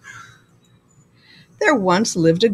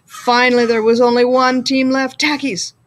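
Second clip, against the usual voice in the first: usual speaking voice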